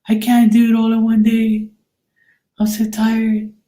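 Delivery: high-pitched mocking whine; mock whiny readers. Teasing readers who can't do every sign in one day, mock whining. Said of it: "How many of these readers that do".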